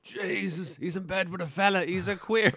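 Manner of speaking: high, loud mocking yell; loud jeering call from outside; shouted from balcony.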